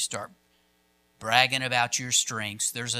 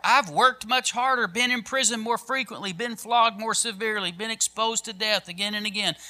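high, driving litany of hardships; raised-pitch, rolling Bible reading; dramatic scripture reading; 10 semitones higher, 5 dB louder than usual. Acted. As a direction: pile up each item in a steady pulpit cadence. Reads Paul's list of hardships.

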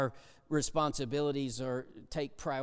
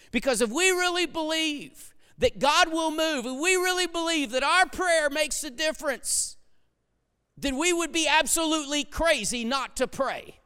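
raised, louder preacher's drive; preaching at full force; 10 semitones higher, 4 dB louder than usual. Acted. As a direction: pound the point home to the congregation.

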